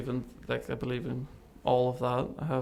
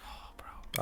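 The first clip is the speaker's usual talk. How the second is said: shocked whisper; 10 dB quieter than usual. Retold or imitated. Stunned at seeing paralyzed girl.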